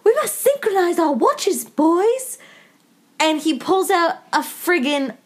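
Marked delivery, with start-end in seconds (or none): 0.0-2.4 lightly voiced pompous elf boy; mock a fantasy hero's grand line, pitched up. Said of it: "the elf boy".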